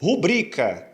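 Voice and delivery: "Rubrica" as ru-BRI-ca; "Rubrica" with a strong bri; stress on BRI. Shown as a demonstration. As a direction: hit the middle syllable of "Rubrica". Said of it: "A sílaba tônica é o bri".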